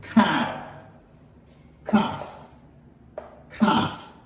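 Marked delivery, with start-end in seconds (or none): 1.8-4.1 "Calf" said without the L, breathy and soft; teacher dropping the L in "Calf"; silent L in calf. Shown as a demonstration. Teacher shows class "Calf" with no L sound. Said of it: "Pas prononcer L là".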